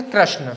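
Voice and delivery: "कृष्ण" as krashna, with ra; common mispronunciation. Shown as a demonstration. Teacher showing what people used to say, "कृष्ण" as krashna. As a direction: drop onto krashna for "कृष्ण", a in place of i. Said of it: "लेकिन इसको क्या बोलते थे?".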